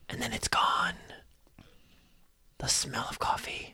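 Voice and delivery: soft, half-whispered storytelling; hushed narration. A narrator's reading. GM narrating a vanished coffee smell, hushed. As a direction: let it fade into an eerie hush.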